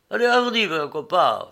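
high, loud rallying call; urging on. A narrator's reading. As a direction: cheer the servant into action. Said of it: "en encourageant son nègre".

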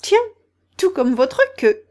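lightly acted astonishment; Alice caught by a discovery; struck by the likeness. A narrator's reading. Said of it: "frappée de la ressemblance".

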